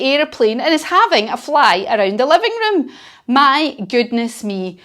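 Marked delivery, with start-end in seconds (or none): none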